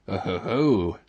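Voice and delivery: self-satisfied low oh-ho-ho; smug chuckle. A narrator's reading. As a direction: Toad chuckling at his own cleverness. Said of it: "he said to himself".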